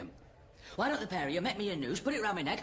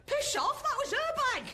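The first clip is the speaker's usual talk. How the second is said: angry yell; slower than usual. Acted. Accused of carrying drugs.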